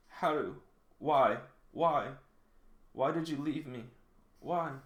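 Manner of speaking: high, halting plea; wounded cry of why to someone who left; anguished pleading. Acted. Voices a character's anguished flashback.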